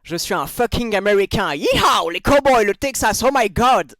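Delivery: loud American cowboy caricature; hammed-up American accent in French. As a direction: whoop it up like a Texan American. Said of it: "l'accent américain".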